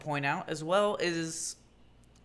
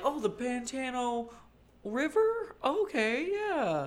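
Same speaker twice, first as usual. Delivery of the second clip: put-on high, slow puzzled old-timer; old person confused; 9 semitones higher, slower than usual. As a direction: sound baffled and elderly. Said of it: "They're like".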